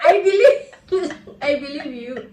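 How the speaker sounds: giggling through the words; laughing. Real, not acted. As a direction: laugh while you say it. Giggling while agreeing.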